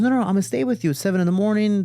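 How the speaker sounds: high, urgent mom objection; insistent mom. Retold or imitated. Retold protective mom insists.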